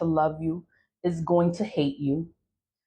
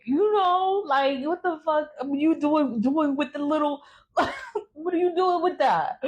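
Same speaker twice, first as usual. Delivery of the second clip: incredulous mock outrage; 9 semitones higher than usual. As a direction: squeal what are you doing in mock shock. Mocking the ugly guy's choice.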